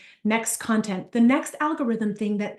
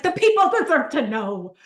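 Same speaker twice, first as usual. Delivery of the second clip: louder, higher righteous proclamation; 5 semitones higher, 3 dB louder than usual. Retold or imitated. Answering her husband about reviews, righteous declaration. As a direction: proclaim it like a crusader for truth. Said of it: "I'm, like, really righteous".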